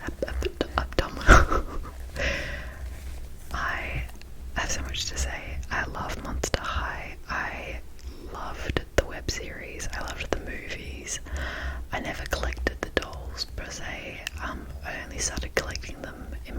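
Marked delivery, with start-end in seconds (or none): none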